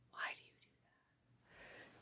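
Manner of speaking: slow, barely audible whisper; whispered question. Real, not acted. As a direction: whisper it very slowly under the breath. Startled aside to something nearby.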